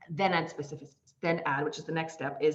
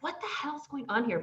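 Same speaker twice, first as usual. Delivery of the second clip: exasperated; 9 semitones higher than usual. Retold or imitated. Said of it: "I was like".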